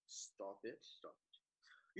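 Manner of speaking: whispered self-scolding; muttering to self. Acted. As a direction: hiss it at yourself under your breath. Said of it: "scolding self after repeated flubs".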